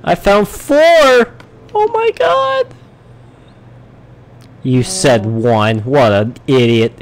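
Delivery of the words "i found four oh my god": much higher, slow, stretched-out oh my god; a real delighted gasp; thrilled disbelief. Real, not acted. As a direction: savour the disbelief at the haul. Finds four diamonds.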